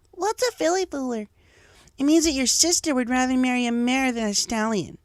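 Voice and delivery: put-on high young pony voices; filly voices. Schoolfillies question each other in high voices.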